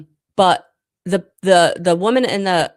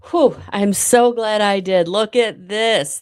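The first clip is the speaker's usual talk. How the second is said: louder and higher, delighted relief; "Whew" blown out, then glad and lively; excited relief; 4 semitones higher, 6 dB louder, slower than usual. Real, not acted. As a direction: burst out happily at the card. Reacting to drawn card.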